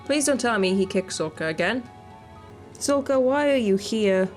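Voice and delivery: usual speaking voice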